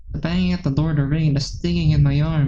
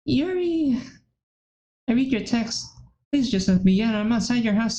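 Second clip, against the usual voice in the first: panicked pleading; 7 semitones higher than usual. Acted. Midas calls Yuri in panic.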